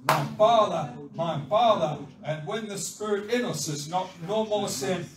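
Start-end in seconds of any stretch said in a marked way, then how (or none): 0.1-2.0 loud, impassioned cry to God; fervent, forceful calling. Acted. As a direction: belt it out from the pulpit. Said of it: "the Spirit within us is crying".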